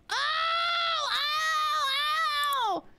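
sudden high yelps of pain, ow ow; real pained yelps. Real, not acted. Streamer hurting herself on camera, yelping in pain. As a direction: yelp out, hurt for real.

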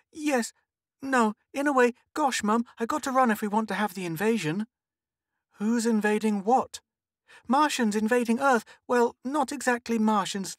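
put-on high, urgent little girl; excited child. A narrator's reading. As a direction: explain to mum in a rush, high-pitched and faltering.

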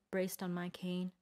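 ordinary delivery

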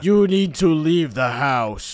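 slow, menacing monster voice; scary voice. Pretend. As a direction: drag it out like a spooky ghost. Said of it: "get your scary voice on".

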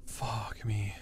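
whispering. Real, not acted. Sneaking past monster, whispering.

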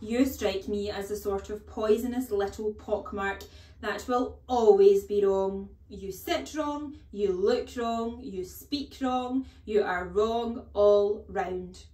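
loud, slowing, each wrong hammered; scornful tirade. Acted. Trunchbull berating the boy.

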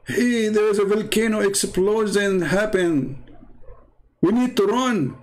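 hammed-up panic call; mock urgent. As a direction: urge them to flee, half-laughing. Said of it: "Like somebody telling you".

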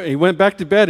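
ordinary delivery, nothing marked

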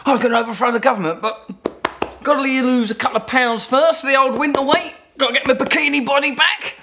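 self-distracted everyman. Voices a distracted person's excuse.

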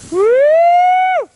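loud, high whoop; cheering whoop. Real, not acted. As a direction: whoop them down the hill. Cheering a sledder on.

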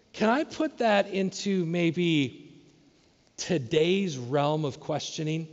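ordinary delivery

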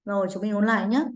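normal talking voice

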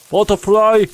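excited shout; excited call. Real, not acted. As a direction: sing out the word on spotting it. Spotting a butterfly.

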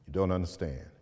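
ordinary talk

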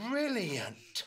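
ordinary delivery, nothing marked

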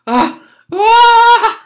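short gasp into a loud high scream; acted scream. Boy sees his hands covered in blood, acted scream. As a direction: shriek in horror, high and loud.

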